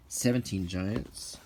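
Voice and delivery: ordinary talk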